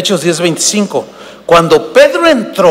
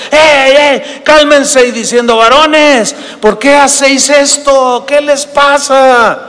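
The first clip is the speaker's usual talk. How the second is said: apostles shouting; 7 semitones higher, slower than usual. Retold or imitated. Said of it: "gritando, dando voces".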